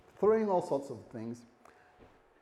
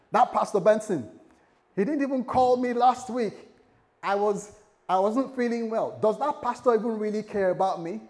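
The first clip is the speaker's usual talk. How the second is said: louder, higher complaining voice of a hurt church member; aggrieved churchgoer; 7 semitones higher, 6 dB louder than usual. Acted. Churchgoer grumbling about the pastor, aggrieved and louder. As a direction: moan about the pastor who never called.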